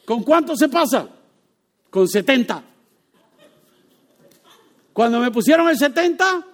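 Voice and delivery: ordinary talk, nothing marked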